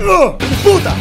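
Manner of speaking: loud, high cursing outburst; frustrated yell. Real, not acted. Gambler cursing a lost spin, frustrated yell. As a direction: explode in a real high yell. Bet fails after pleading.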